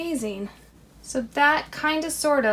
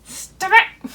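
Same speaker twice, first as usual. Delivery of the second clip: loud, higher snap at the fabric; mock scolding; 8 semitones higher, 6 dB louder, much slower than usual. Real, not acted. Crafter scolding the slipping balloon, loud and high. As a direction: tell the fabric off, loudly.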